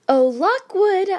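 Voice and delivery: cooing. A narrator's reading. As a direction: coo his name sweetly with urgency. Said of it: "I cooed again".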